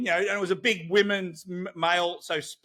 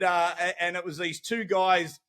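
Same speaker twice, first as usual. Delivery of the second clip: ordinary delivery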